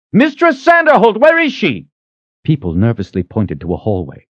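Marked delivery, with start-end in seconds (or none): 0.1-1.9 calling out. A narrator's reading. Richard calls out for the head cook, loud. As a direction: call down the kitchen. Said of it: "he called out".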